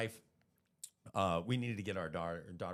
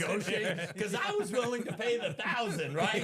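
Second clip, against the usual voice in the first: amused excited retelling; 9 semitones higher, 5 dB louder than usual. Real, not acted.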